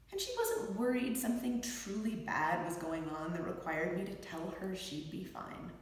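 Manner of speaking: normal talking voice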